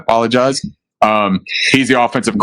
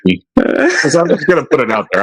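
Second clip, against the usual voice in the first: usual speaking voice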